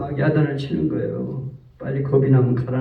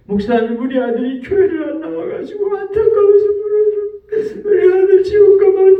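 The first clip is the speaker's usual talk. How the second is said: high, tearful pleading in a breaking voice; crying plea; 5 dB louder than usual. Retold or imitated. Mother weeping to the pastor about her son, crying high. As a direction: beg through tears for her son. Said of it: "우는 거예요".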